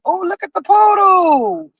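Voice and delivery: high, slow delighted call-out; excited exclaim. Real, not acted. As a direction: gush over the dog you just spotted. Spots a poodle on stream.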